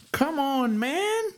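high, exasperated plea. Real, not acted. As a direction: throw up your hands in disbelief. Exasperated at the old text.

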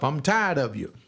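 ordinary delivery

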